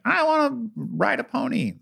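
put-on high, slow, sing-song child voice; mock little girl. Dad mocking kids who only want ponies, high and sweet. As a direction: play a sugary little girl.

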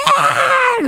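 short piercing high scream; imitated child scream. Mother reenacting her son's meltdown, high scream. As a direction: let out a sudden shrill child's shriek.

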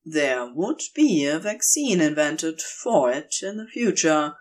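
ordinary delivery, nothing marked

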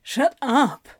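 a touch of panic; flustered, understated protest; mildly panicked. A narrator's reading. Crowley protests at being admired, mildly panicked. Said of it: "sounding more panicked than anything else".

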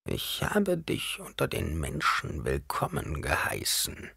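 slow, hushed whispery murmur. A narrator's reading. Reverend Mother answering Paul, slow whispered murmur. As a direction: murmur it softly and slowly. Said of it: "murmelte die ehrwürdige Mutter".

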